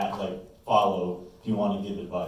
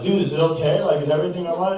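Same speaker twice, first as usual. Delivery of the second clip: higher, louder, alarmed check-in; raised voice of startled concern; concerned roommate; 7 semitones higher, 6 dB louder than usual. Acted. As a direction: play a worried friend asking. Roommate reacts to him crying.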